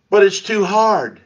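high, loud, drawn-out complaint; hammed-up protest, slowly stretched; mock whining. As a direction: whine like a reluctant pupil. Mimics people complaining about the challenge.

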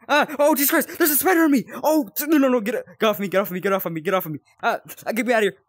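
loud, high panicked yelling; panicked screaming. Real, not acted. Player with a spider on him, panicked screaming. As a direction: shriek it off in panic.

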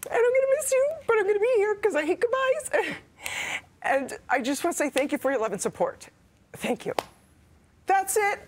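high, cracking voice through sobs; barely getting the words out; tearful thank you to viewers; crying goodbye. Real, not acted. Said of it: "I got through it almost".

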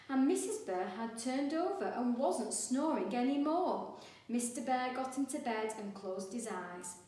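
ordinary delivery, nothing marked

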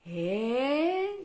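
rising, drawn-out "eh" before a sneeze; pre-sneeze gasp. A narrator's reading. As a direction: sneeze building up. Character about to sneeze.

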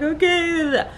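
high tearful okay, imitated; hammed-up weepy squeak; mock crying. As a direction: act out a weepy agreement. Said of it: "So I'm crying".

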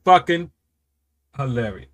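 ordinary talk

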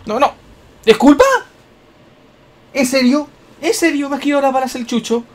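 high, loud incredulous; can't believe the dog dodged; shrill indignant disbelief; outraged disbelief. Real, not acted.

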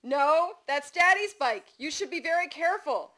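put-on high, loud, insistent little-kid voice; toddler yell. A narrator's reading. Toddler correcting mother about Daddy's bike, high insistent yell. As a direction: scold like a bossy two-year-old. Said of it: "Dawson yelled".

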